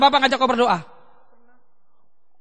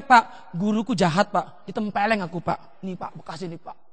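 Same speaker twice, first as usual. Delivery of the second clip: imitated tattling child; 5 semitones lower, 6 dB quieter than usual. Child complains to father about teacher.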